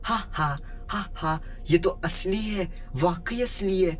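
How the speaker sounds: high-pitched, crazed squealing with spoken ha-ha laughs; hammed-up mad delight, high but not truly shouted; manic excited glee. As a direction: act out a crazy girl overjoyed at her find. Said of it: "पागलों जैसी हंसी में चीख चीख कर बोलने लगी".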